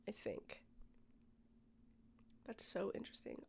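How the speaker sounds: soft whisper, barely voiced; whispered aside. Real, not acted. Distracted by window cleaners outside, she whispers. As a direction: murmur it to yourself, not to the audience.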